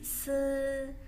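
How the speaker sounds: high, drawn-out "se" with a schwa-like vowel; slow, held "se"; Mandarin s sound. Shown as a demonstration. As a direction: say "se" as a long hissed s plus held vowel, not the letter name. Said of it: "bacanya bukan S".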